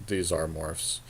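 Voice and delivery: normal talking voice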